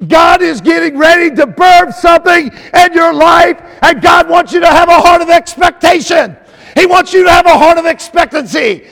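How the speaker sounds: loud, high preacher's shout; full-force preaching. Real, not acted. Preacher telling congregation to expect from God, full-force preaching. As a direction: drive it home to the whole room.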